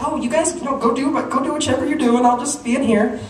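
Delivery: high, sing-song casual reassurance; fake innocence. Son shooing parents from the kitchen, fake innocence. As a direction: put on a too-innocent high voice. Boy feigning innocence while drugging smoothies.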